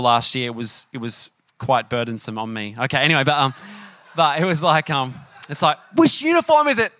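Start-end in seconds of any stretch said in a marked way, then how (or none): 5.9-6.9 high-pitched, mock-exasperated question; flustered parent. Parent getting kids dressed for school, high flustered outburst.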